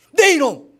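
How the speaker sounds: sharp high shout of scolding; angry rebuke. Pretend. Jesus snapping at Peter, a loud angry shout. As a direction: bark it like a furious master.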